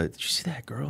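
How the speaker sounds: breathy hushed aside, no voice at all; retold whisper. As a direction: whisper it as if she might overhear. Buddy whispering about a girl.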